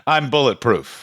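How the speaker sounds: ordinary talk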